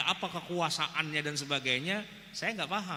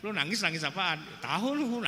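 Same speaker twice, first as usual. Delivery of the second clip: ordinary talk, nothing marked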